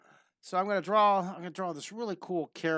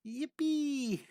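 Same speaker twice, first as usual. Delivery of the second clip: high, playful cheer without extra volume; high-pitched happy cry; gleeful squeal; 8 semitones higher than usual. Acted. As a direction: cheer lightly as a delighted cartoon girl. Voicing girl having time of life.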